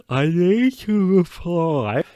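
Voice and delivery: high, loud, drawn-out cry; mock urgent realisation. Host suddenly remembering an unanswered message, mock alarmed.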